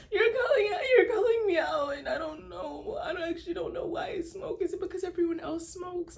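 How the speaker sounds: high tearful put-on wail, laughing through it; fake crying. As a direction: cry it out as an act. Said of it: "But most of it's an act".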